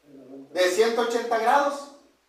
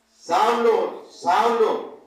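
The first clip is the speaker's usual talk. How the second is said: calling out; 4 dB louder, much slower than usual. Acted. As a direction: voice of the Lord calling a name. Voz divina que llama a Saulo. (llamando en voz alta)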